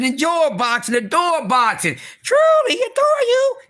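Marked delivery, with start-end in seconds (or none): 2.2-3.6 high bird voice. Pretend. Vlogger croons to the audience in a high falsetto. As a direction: float it way up in a thin falsetto. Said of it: "motherfucking goddamn bird voice".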